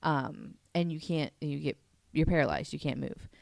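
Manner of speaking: ordinary talk, nothing marked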